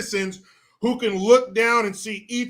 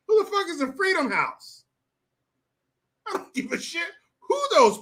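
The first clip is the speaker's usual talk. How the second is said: outraged; 11 semitones higher than usual. Real, not acted. Outraged rant.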